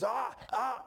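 high-pitched protesting cries; imitated angry sputter. Worker refuses the apology, imitated angry sputter. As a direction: cut in with wordless mock outrage. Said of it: "acted like he wanted to fight".

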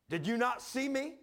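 high, loud, slow challenge; imitated officer's rebuke. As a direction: demand an answer like an affronted officer. Officer confronts him over the salute.

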